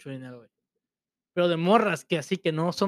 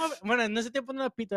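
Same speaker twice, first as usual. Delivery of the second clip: ordinary talk, nothing marked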